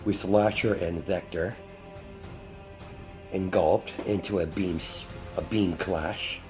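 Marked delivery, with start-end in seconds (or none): none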